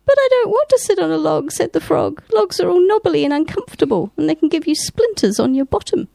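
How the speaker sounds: high, quick complaining frog; whiny frog voice. A narrator's reading. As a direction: squeak it like a put-upon little frog. Frog answers cat in story.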